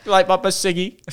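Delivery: high-pitched mock British; British accent. Co-host joining the British bit, high mock British accent. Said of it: "British accent the entire time".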